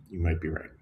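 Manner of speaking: quiet humble "You might be right."; sincere, no attitude. Shown as a demonstration. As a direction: say it gently with no edge at all. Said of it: "Not with an attitude".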